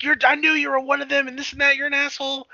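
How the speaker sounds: high, loud mock angry rant; retelling a tied-up woman raging at him, mock angry and high; pissed off. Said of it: "she's all pissed off at him".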